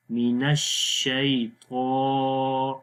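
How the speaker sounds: slow drill of الشیطان with a long, thick 'taa'; long, heavy vowel. Shown as a demonstration. Teacher showing a student the long vowel, الشیطان stretched heavily. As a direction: draw out the last vowel of الشیطان, full and heavy. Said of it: "لمبا بھی کریں گے، موٹا بھی پڑھیں گے".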